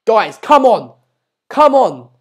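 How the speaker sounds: loud, high rallying call; urging viewers. Real, not acted.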